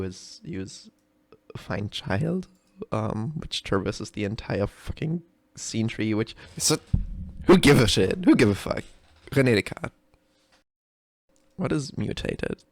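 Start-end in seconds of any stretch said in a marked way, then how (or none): none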